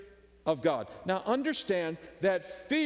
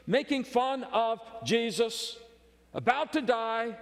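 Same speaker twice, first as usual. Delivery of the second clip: usual speaking voice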